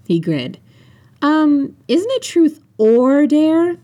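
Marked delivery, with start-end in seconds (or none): 1.2-3.8 high, drawn-out querying; slow, high-pitched correction; sceptical question. A narrator's reading. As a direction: question it, stretching the words out. Phoebe questions the game's name.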